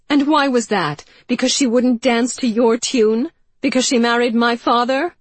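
high, loud accusing questions; angry accusation. Acted. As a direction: hurl the questions at her. Anne confronts her aunt.

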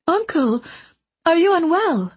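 put-on high, worried young girl's voice; anxious and caring, rushing to him; concerned niece. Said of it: "rushing to him in concern".

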